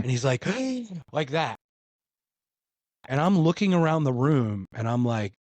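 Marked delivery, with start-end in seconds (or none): 0.5-1.1 sharp imitated gasp, high and airy; mock gasp. Imitating the medium jerking back with a gasp. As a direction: act out his startled gasp. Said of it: "he gasps".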